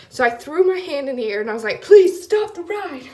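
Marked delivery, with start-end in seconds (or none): 1.8-3.1 high, loud, slow plea to stop; retold panicked plea, high and loud; pleading cry. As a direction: beg them to stop it, half shouting. Pleading to stop ride mid-countdown.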